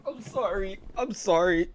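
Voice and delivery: normal talking voice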